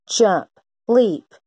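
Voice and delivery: ordinary delivery, nothing marked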